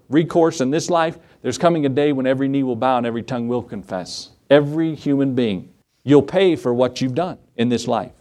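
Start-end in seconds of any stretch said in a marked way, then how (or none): none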